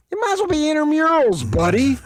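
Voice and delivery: loud, high squeal of disbelief; excited comeback. Real, not acted. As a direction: fire it off fast and high. Incredulous comeback, pitch leaps.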